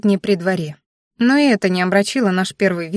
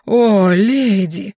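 slow, loud, hammed-up suitor's croon; mock bass voice; 4 dB louder, much slower than usual. A narrator's reading. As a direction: boom it languidly as a pretend man. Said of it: "И тут же пробасила". (нарочитым басом, протяжно)

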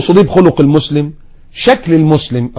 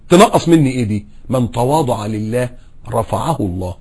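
ordinary talk, nothing marked

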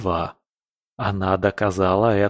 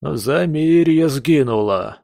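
howling; 5 semitones higher, slower than usual. Acted. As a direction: howl it out like a grieving dog. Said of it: "Провыл". (протяжный вой)

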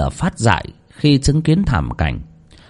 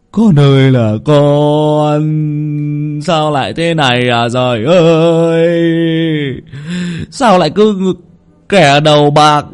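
slow, loud, keening lament; an old man crying out over his dead nephew; wailing sob; 7 semitones higher, 5 dB louder than usual. Acted. Lão Nhiên mourning the dead boy, slow and loud, sobbing. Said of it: "mếu máo khóc".

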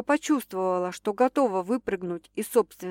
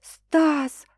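high female cry; girl crying out; 7 semitones higher, 5 dB louder than usual. A narrator's reading. Girl crying out to Stas, high. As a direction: cry his name high.

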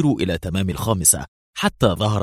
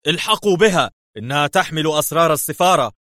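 very high, loud shout; 8 semitones higher, 4 dB louder than usual. Acted. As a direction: yell the order to chase. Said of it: "فصاح برونو".

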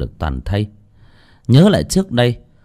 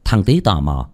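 ordinary delivery, nothing marked

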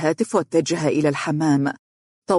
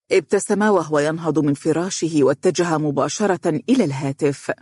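ordinary delivery

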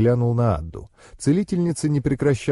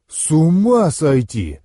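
drawn-out, higher exclamation of admiration; awed delight; 4 semitones higher, 4 dB louder, slower than usual. A narrator's reading. Andrey reacting to his friend's summer story, awed delight. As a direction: marvel slowly, stretching the words. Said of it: "восхищался Андрей".

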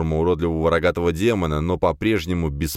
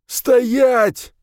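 high, loud gunpoint order; forceful high yell; shouted command; 6 dB louder than usual. Acted. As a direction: shout it to freeze someone. Gunman orders hero to freeze.

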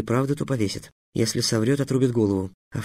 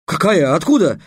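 higher, louder surprised questions; 6 semitones higher, 6 dB louder than usual. A narrator's reading. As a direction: caught off guard, asking quickly. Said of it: "удивился Трикс". (удивлённые вопросы, громче и выше)

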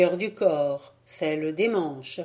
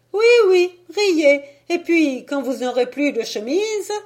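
much higher, louder, mocking warning; shrill scolding housekeeper; 9 semitones higher, 9 dB louder than usual. A narrator's reading. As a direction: fling it after him as he leaves. Fantille retorts to the departing priest. (servante vexée, voix très aiguë et forte)

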